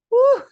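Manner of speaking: high, loud cheering whoop; excited whoop. Real, not acted. Instructor whooping at the class over the sweaty little moves. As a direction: let out a real whoop of effort.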